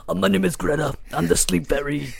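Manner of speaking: thick Long Island puppet fairy; Greta's puppet voice. Acted. Puppet Greta introducing herself, thick Long Island fairy voice. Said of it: "That's how she talks".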